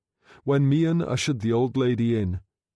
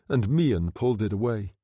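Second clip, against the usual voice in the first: ordinary delivery, nothing marked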